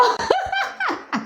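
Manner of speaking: high burst of laughter. Real, not acted. Laughing at own tweet reading.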